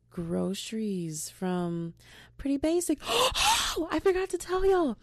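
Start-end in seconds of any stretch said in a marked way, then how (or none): 2.4-3.0 excited. Real, not acted. Excited realization of errand. 3.1-3.7 a big high gasp of sudden remembering; sharp rising gasp. Real, not acted. Gasps on remembering she forgot to tell listeners. 3.8-5.0 high, eager rush of news; bursting to share something; excited. Real, not acted. Excited to tell her listeners the news.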